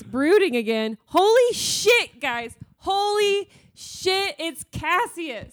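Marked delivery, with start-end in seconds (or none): 1.1-5.5 thrilled amazement. Real, not acted. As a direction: gush in joyful disbelief, stretching key words. Celebrating a character's return.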